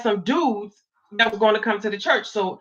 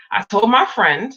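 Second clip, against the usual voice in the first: ordinary talk, nothing marked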